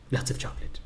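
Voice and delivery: soft, hushed whisper; mock-solemn whisper; whispered punchline.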